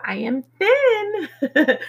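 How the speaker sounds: high, long drawn-out final word; playful affirmation. Real, not acted. Speaker laughing over their own affirmation, playfully drawn out. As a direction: stretch it out high, on the edge of laughter.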